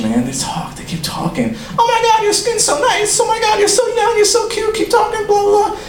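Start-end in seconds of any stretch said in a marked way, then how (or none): 1.8-5.8 put-on very high, squealing old-lady voice; imitating chatty old lady. Old lady on the subway, mocking chatty falsetto. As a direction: gush in a mocking falsetto without letting up. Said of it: "old ladies talk so much".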